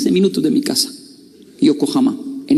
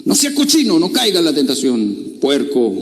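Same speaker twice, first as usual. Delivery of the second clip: put-on scolding old man. Old man scolding a youngster about temptation. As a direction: lecture him like a stern elder. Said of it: "decirle al muchacho".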